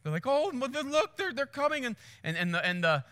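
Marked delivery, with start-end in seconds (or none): 0.2-1.9 high-pitched onlooker voice; mock alarm, pitched up; imitated anxious tourists. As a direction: point it out in a high, urgent tourist voice. Imitating tourists watching lions crouch.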